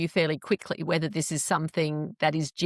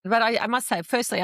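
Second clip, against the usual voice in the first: usual speaking voice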